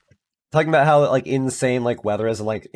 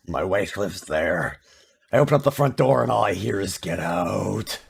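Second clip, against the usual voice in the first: Walter impression; 3 semitones lower than usual. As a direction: grumble it like a sour husband. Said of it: "he was just like".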